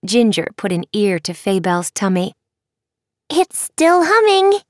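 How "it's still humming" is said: put-on high, delighted little girl; slow, amused child; giggly girl. Ginger listens at tummy.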